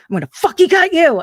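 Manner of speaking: high, loud, fast threat; mock threat shout. Coworker threatening the bound man, loud mock threat. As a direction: snap the threat out. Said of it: "he's like".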